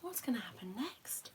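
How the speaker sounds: soft whispered question. Real, not acted. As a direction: whisper it with hushed suspense.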